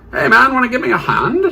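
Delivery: put-on high, quick British-accented call. Surgeon flags down a hospital cleaner, high British accent. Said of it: "burst into a British accent there".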